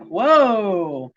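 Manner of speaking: ordinary talk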